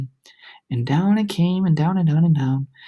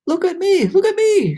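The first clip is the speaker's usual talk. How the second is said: high, loud gleeful shout; excited shout; 14 semitones higher, 5 dB louder than usual. A narrator's reading. Centipede freed by the rain, high excited shout. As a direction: crow with delight. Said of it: "shouted the centipede excitedly".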